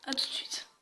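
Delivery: unvoiced whisper goodbye; whispered aside. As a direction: breathe it out like a secret. Chuchote avant la coupure. (chuchoté)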